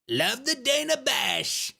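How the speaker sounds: hammed-up, drawn-out parody; Donald Trump. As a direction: stretch it out in a mock presidential voice. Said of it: "I hate when people do Trump accents".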